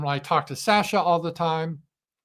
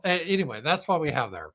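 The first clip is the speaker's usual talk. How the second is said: normal talking voice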